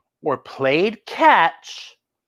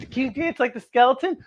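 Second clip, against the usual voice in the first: ordinary delivery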